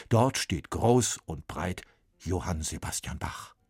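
ordinary delivery

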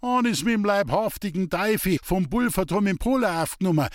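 high-pitched sailor's brag; boasting. A narrator's reading. Sailor Bertus facing down a ghost, boasting. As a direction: boast as if no devil scares you. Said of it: "prahlte jetzt der Matrosen Bertus".

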